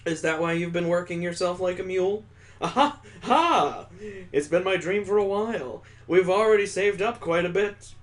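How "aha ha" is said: hearty character laugh. Acted.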